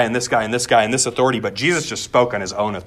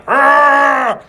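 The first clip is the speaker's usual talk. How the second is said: long, loud, high-pitched yell; sustained high "arrgh" holler; imitated yell; 7 dB louder than usual. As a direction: mimic a man bursting into a raging scream. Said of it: "stand up and start yelling".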